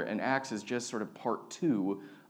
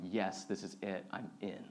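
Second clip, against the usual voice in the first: soft, low voicing of converts; hushed quote; 4 semitones lower, 9 dB quieter than usual. Pastor voices three thousand converts deciding to join, hushed. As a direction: say it quietly, dropping away at the end.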